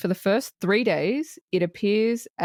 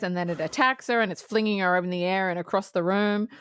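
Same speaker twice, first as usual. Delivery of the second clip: usual speaking voice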